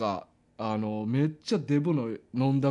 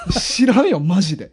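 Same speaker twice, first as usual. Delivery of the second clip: high, loud protest through laughter; laughing protest; 5 semitones higher, 7 dB louder than usual. Real, not acted. Storyteller pressed on a plot hole, laughing protest. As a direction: plead ignorance loudly, laughing. Laughing denial of knowing the reason.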